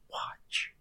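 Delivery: hushed slow whisper. Acted. Preacher whispers the instruction to watch, calmly. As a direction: draw them in with a breathy hush.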